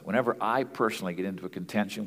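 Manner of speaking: ordinary talk, nothing marked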